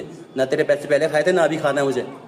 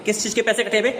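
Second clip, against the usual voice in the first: गुस्से वाला ताना; 7 semitones higher, faster than usual. Real, not acted.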